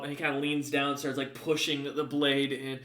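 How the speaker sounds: usual speaking voice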